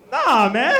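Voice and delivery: normal talking voice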